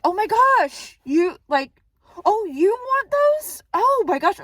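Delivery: high, slow, stretched "oh, you want those?"; shocked realisation. Retold or imitated. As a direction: it finally dawns on her and she is stunned. Shocked realisation he's hungry.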